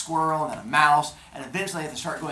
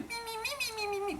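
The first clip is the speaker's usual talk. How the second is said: tiny, very high squeaky insect voice, softer; gnat talk; 8 dB quieter, faster than usual. Acted. Metis as a gnat circling Zeus, tiny squeaky gnat voice. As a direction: buzz like a gnat taunting him, way up high. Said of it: "which is gnat talk".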